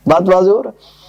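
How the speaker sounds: ordinary delivery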